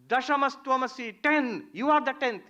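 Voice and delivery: high, loud proclamation; triumphant declaration. Retold or imitated. Wise man points at the friend and declares him the tenth, loud. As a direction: announce it like a revelation.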